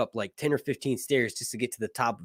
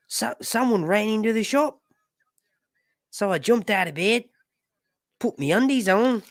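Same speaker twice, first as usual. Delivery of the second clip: broad Australian, pitched up, questioning lilt; Australian interviewee impression; 10 semitones higher, 3 dB louder than usual. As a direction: tell it as a hammed-up Aussie storyteller. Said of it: "this guy right here being interviewed".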